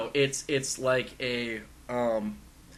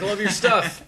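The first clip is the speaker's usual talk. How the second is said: higher, louder fan praise; retold fan gushing; 8 semitones higher, 7 dB louder than usual. Fan praising John Drake's work, retold gushing. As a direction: gush as an admiring fan.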